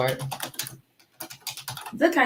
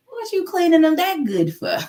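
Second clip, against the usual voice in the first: suspicious mom; 12 semitones higher, 4 dB louder than usual. Retold or imitated. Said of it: "I was like".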